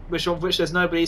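ordinary talk

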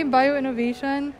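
usual speaking voice

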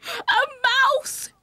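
very high, loud shriek; piercing, hammed-up storybook shriek; aunt's scream. A narrator's reading. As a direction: scream in horror at the sight. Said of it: "Willie's aunt screamed".

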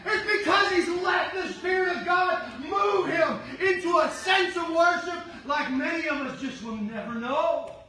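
normal talking voice

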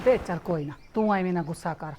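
soothing mother. Acted. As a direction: hush him gently to rest. Mother calms angry son.

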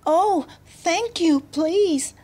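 high, drawn-out delight; joyful. A narrator's reading. Girl thanking the butterflies, joyful high voice. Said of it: "said Anne joyfully".

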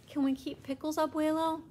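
high, loud hopeful plea; pleading child. Acted. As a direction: beg grandpa with big eyes.

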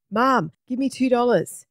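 put-on high-pitched child's demand; urgent little boy. A narrator's reading. Simon turns to his mum, urgent little boy. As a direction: pitch it up like an insistent kid.

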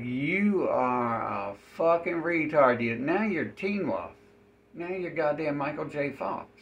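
loud sarcastic jab at the NPC; loud mocking retort. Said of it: "And I'm like".

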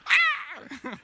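high, bursting laugh that breaks into a loud cry; cracking up, laughing hard; real burst of laughter. Real, not acted. Preacher laughing at the snake punchline.

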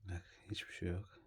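hushed whisper to the viewers; barely voiced aside. Real, not acted. Whispering near the pet.

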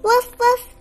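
puppy barks. Acted.

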